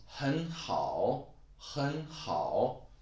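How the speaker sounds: 很好 with 很 rising as a second tone; tone sandhi demo. Shown as a demonstration. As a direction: say 很好 slowly, rising on 很. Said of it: "then it becomes".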